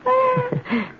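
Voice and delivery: a high, nervous little laugh; short high uneasy laugh. Acted. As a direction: laugh to cover the fear. Nervous laugh alone with cat.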